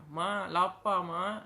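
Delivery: put-on a small child begging for food; hungry child. Hungry child begs mother.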